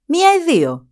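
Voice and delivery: a sharp, high-pitched question; old woman asking. Acted.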